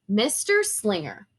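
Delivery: ordinary delivery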